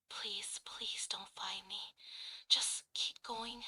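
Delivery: hushed frightened whisper; whispering while hiding. Acted. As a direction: whisper so the creature can't hear. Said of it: "it will go something like this".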